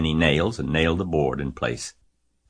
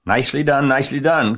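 high, loud cheering; goose crying out; 9 semitones higher, 7 dB louder, faster than usual. A narrator's reading. Goose cheers Wilbur's dodge, crying out. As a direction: cheer him on in a raised voice. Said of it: "cried the goose".

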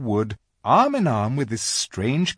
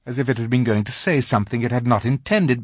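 ordinary delivery, nothing marked

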